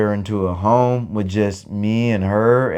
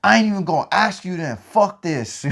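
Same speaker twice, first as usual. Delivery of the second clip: much higher, exasperated snap; frustrated outburst; 7 semitones higher than usual. Retold or imitated. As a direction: throw up your hands, fed up. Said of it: "I'm over here getting frustrated".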